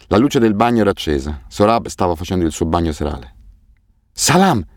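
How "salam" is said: bright-pitched, excited hello; high burst of excitement; excited greeting. A narrator's reading. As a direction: greet them in an excited, much higher voice. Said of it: "Soraya sembrava eccitata".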